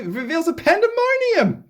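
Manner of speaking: mock dramatic. Mocking the book's claims.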